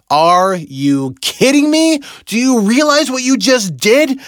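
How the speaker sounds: high, loud scolding inner voice; logical brain screaming. Retold or imitated. His logical brain scolding him, high loud alarm. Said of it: "screamed at me and freaked me out".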